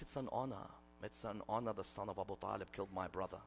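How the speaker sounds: very soft and low, retold quote; a sister's subdued, honored acceptance; hushed reverence. Bereaved sister calmly declares it honor.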